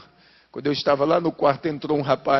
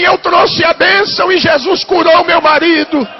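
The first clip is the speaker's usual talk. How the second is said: loud, high, shouting sermon climax; preaching at full force; 11 semitones higher, 8 dB louder than usual. Acted. Pastor shouting the healing testimony to the congregation, full force preaching. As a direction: proclaim the miracle at the top of your voice.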